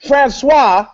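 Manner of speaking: high, loud mock-diva whine; a pampered star begging for something ordinary. The singer turning to her manager, in a high loud mock-diva voice.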